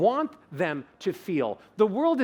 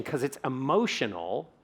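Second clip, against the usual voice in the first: ordinary talk, nothing marked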